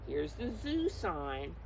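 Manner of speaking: ordinary talk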